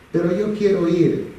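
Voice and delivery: a hint of a stubborn little boy; whiny child insisting. Son pushing back at his father, whiny child voice.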